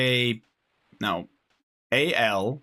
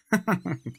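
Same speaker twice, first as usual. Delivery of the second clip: high amused chuckle; short laugh; 8 semitones higher than usual. Real, not acted. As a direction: laugh it off quickly. Laughs after brb.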